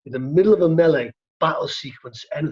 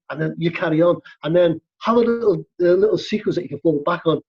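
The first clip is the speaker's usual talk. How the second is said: usual speaking voice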